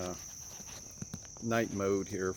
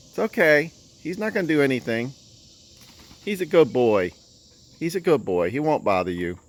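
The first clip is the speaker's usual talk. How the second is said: higher, louder, soothing voice; 6 semitones higher, 9 dB louder than usual. Real, not acted. Calming scared chickens.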